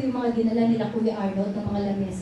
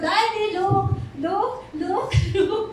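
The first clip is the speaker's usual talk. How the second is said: joyful laughing; 6 semitones higher, slower than usual. Retold or imitated. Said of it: "I'm really crying in joy".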